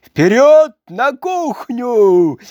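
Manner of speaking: loud drawn-out shout of Вперёд; battle cry. A narrator's reading. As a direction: shout it like a rallying call. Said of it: "закричал он".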